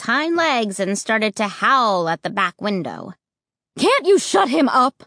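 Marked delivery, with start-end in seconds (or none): 3.8-5.0 high, restrained storyteller's scream; exasperated mother raising her voice high. A narrator's reading. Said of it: "Mom screamed".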